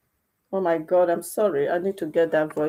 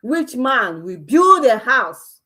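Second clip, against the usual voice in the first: indignant slow question; 4 semitones higher, 7 dB louder, slower than usual. Real, not acted.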